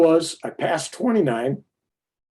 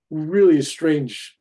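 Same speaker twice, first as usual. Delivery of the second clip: slow emphasis; 3 semitones higher, 4 dB louder, much slower than usual. Real, not acted.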